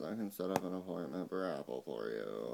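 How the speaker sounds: a mocking take on the phone rep; imitating the agent. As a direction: mimic his stiff phone manner. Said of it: "And he talks like this".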